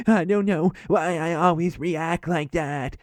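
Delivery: high, slow, wailing fake cry; mock bawling. Neighbor hugging the lady after they leave, bawling. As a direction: imitate a sobbing wail, stretching the words. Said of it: "he's just bawling his eyes out".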